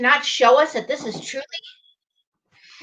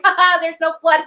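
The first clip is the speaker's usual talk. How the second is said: mock laughing jeer; 5 semitones higher than usual. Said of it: "everybody laughing at me and going".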